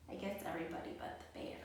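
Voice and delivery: quick, hushed aside whisper; fast whispered aside. Acted. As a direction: whisper it to the kids like a secret. Sly aside about the bear.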